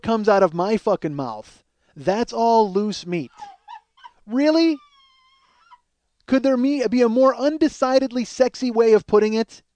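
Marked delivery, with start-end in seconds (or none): 1.9-3.3 slow, deadpan quote of his own line; slow deadpan self-quote; slow deadpan repeat. Retold or imitated. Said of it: "That's what I say". 4.3-9.6 exasperated outburst. Real, not acted. Comic outrage at himself.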